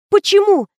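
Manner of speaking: high, loud alarmed question; urgent, pitched right up; bewildered. A narrator's reading. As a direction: demand a reason in dismay. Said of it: "принялась задавать бестолковые вопросы".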